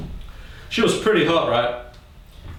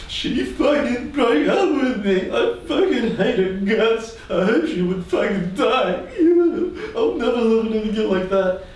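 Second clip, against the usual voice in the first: mock heartbroken rant; 8 semitones higher than usual. Ranting to friends after breakup.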